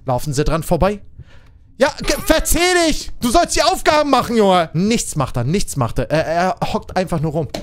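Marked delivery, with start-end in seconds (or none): 1.8-4.7 mock scolding. Real, not acted. Streamer scolds an idle player, high exasperated. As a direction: tell off a lazy teammate.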